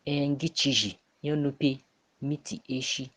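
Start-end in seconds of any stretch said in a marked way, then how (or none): none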